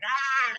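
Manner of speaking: a short, high, hammed-up scream of rage; mock tantrum scream. Wife acting out her tantrum to husband, high mock scream. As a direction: let out a staged toddler-tantrum shriek. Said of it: "rolling on the floor and going".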